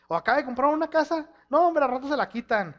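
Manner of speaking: high, loud sneering gossip; mocking gossip. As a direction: sneer it like a jealous neighbor. Mimicking gossip about a neighbor.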